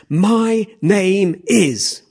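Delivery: deliberate declaration. Acted. Preacher showing how the hero announces himself, slow and grand. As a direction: stretch every word out grandly. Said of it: "I just love the way he says this".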